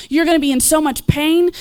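usual speaking voice

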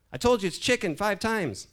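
loud, high exasperated wife; exasperated shout. Acted. Wife to husband who keeps asking, exasperated shout.

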